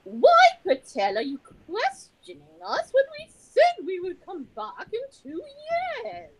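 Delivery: high, theatrical mock English accent; hammed-up English accent, loud and indignant. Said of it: "English accent".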